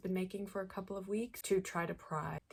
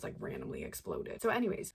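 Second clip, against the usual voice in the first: ordinary talk, nothing marked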